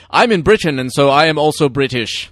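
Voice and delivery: playful fake British accent, mocking her. Podcast host mocking Carrie Fisher's fake British, in put-on British accent. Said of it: "her whole thing, it's like".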